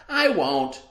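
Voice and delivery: put-on bright high little girl promising; high girlish voice. Girl promising her mother, high sweet child voice. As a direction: chirp it like a small eager girl.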